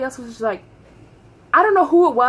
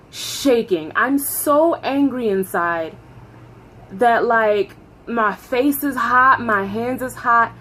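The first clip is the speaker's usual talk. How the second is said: plain reading voice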